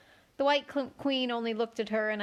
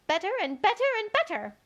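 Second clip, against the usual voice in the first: climbing higher with each better, ending in a squeak; rising to a squeak; 10 semitones higher, 4 dB louder than usual. A narrator's reading. Queen's voice climbs to a squeak at Alice. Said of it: "Her voice went higher with each better".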